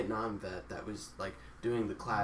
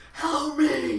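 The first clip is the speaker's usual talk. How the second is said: high-pitched, loud mock cry; mock scream for help; 9 dB louder than usual. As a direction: yell for help, hammed-up. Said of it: "Someone's like".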